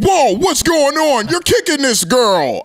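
security guard. Retold or imitated. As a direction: bark it like a startled bouncer.

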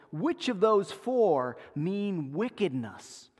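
ordinary delivery

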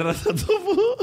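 high laughing yell of "ratotopo"; laughing shout. Real, not acted. Streamer squealing "ratotopo" while laughing.

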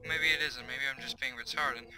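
usual speaking voice

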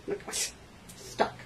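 ordinary talk

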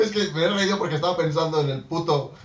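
ordinary talk

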